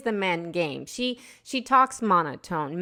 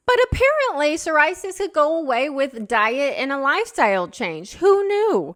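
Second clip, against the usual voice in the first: high, loud, amazed; excited; 5 semitones higher, 4 dB louder than usual. Real, not acted. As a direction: burst out with surprise. Excited aside.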